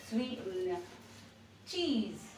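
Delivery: ordinary delivery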